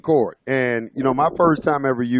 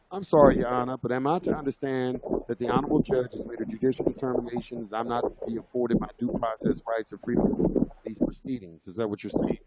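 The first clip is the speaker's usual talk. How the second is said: quiet, measured, composed reply; very calm; 7 dB quieter than usual. Retold or imitated. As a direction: keep your cool and speak politely. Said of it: "I said it very, very calmly".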